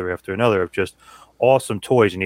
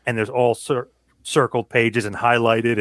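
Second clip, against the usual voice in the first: ordinary talk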